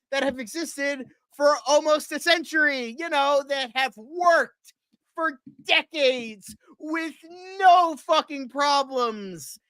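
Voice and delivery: high-pitched, exasperated, stretching out the words; exasperated rant. Real, not acted. Fan ranting about a renamed comic character, exasperated.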